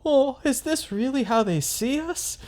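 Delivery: high, hammed-up offended voice; mock the monsters' wounded pride; squeaky mock indignation at a store display. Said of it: "they get all bent out of shape and they're like".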